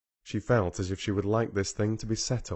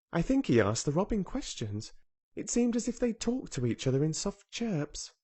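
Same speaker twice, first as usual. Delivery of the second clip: a hint of an earnest young girl, higher; lightly voiced girl Mary; 6 semitones higher than usual. A narrator's reading. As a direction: tell it like a wide-eyed child sharing a secret. Mary's long speech voiced.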